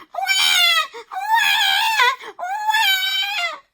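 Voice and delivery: put-on very high, loud baby wail; baby screaming. A narrator's reading. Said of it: "cried even louder".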